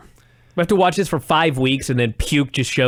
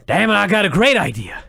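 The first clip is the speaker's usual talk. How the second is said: badly faked accent, pitched up; Vince Russo; 5 semitones higher than usual. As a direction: attempt an excited impression that lands on the wrong man. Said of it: "I can't do my Russo accent".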